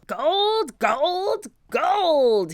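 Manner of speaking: high, loud greedy glee; gloating pirate exultation; crying out. A narrator's reading. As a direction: cry it out high, stretching each word. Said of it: "he cried".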